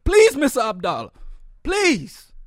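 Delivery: a mocking imitation of a desperate plea on the phone; mock begging president. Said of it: "the president got on the phone like".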